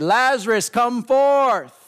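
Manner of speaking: high, loud call into the tomb; commanding shout. Retold or imitated. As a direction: call Lazarus out with full authority. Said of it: "Jesus shouted out into the grave".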